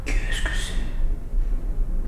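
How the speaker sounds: hushed puzzled whisper. Real, not acted. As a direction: breathe the question out. Alone in dark house, puzzled.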